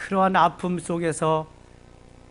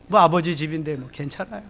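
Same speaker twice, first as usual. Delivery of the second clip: ordinary talk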